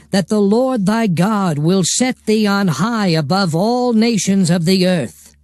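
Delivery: ordinary talk, nothing marked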